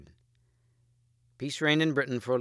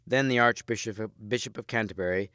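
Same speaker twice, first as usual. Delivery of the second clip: ordinary delivery, nothing marked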